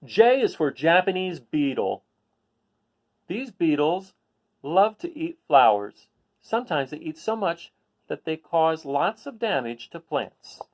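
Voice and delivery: bright, clear picture-book read-aloud; reading to children. Acted. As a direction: read it out to a class of kids.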